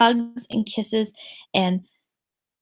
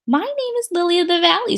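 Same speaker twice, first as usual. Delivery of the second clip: higher, quick proud announcement; prim girl; 7 semitones higher, faster than usual. Acted. Victoria claiming a flower name, proudly. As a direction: announce it grandly.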